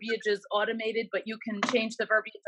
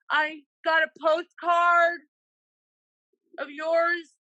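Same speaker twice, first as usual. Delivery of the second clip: slow, loud, higher muffled caller voice; caller through a mask; 7 semitones higher, 5 dB louder, much slower than usual. Retold or imitated. Imitating the masked caller who got her postcard, slow and loud. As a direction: overenunciate like a masked man who is hard to hear. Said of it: "he's wearing a mask ... he started talking like this".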